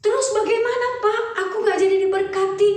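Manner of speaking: a son pleading for his lost blessing; pleading Esau. Acted. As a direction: beg your father, upset.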